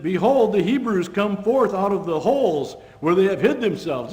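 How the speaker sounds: mocking call. Philistine garrison jeering at the Hebrews, loud and scornful. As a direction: jeer down at them from the garrison.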